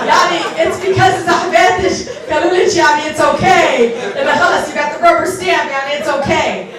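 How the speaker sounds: high, loud, playful protest; loud teasing retort. Retold or imitated. Daughter teases mother about her friends' approval, loud and high. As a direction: tease loudly over the audience's laughter.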